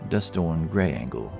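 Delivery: plain reading voice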